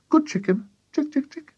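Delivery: high little chicken-calling voice; coaxing. A narrator's reading. George offers chicken the spoonful, high coaxing call. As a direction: coax a hen in a high voice.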